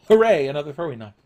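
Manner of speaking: fake-celebrate the lame loot; sarcastic mock excitement; sarcastic hooray. Real, not acted.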